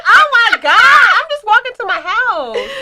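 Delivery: very high, put-on tearful protest; mock distressed wail. Woman hollered at while walking home, mock distressed wail. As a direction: wail it in exaggerated dismay, drawn out at the end. Acted woman fending off catcallers.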